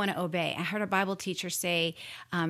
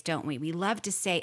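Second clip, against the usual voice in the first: normal talking voice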